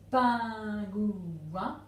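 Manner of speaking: PINGOUIN drawn out as pin... gou... in; three syllables. Shown as a demonstration. Teacher asking where crosses go, PINGOUIN in long syllables. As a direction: stretch each of the three beats in PINGOUIN. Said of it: "trois syllabes dans pingouin".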